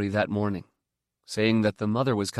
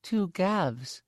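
put-on groggy little boy; 9 semitones higher, 4 dB quieter than usual. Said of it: "he said".

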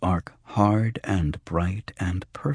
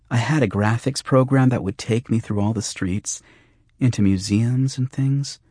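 put-on higher-pitched girl remembering a dream; light, high girl's voice; girl's wistful voice; 5 semitones higher than usual. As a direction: lift it into a girl's register. Angie recalls her dream.

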